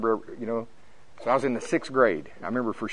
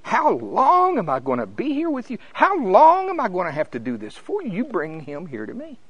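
not happy; 7 semitones higher, 3 dB louder than usual. Acted. Preacher voicing Jesus fed up with his disciples, not happy. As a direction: scold in mounting impatience, then come down. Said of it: "he was not happy".

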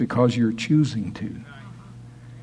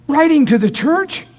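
incredulous; 13 semitones higher, 4 dB louder than usual. Acted. Incredulous question.

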